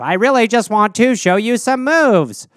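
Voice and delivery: ordinary talk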